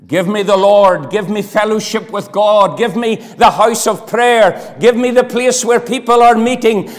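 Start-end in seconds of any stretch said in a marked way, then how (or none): none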